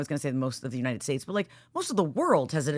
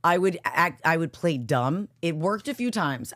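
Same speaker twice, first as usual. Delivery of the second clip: ordinary talk, nothing marked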